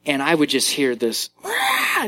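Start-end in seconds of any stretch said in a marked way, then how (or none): 1.4-2.1 high-pitched mad mock scream; angry toddler shriek. Dad imitating his little girl stuck in a corner, mad shriek. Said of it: "just totally mad, not crying".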